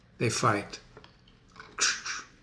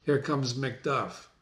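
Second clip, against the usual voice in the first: ordinary talk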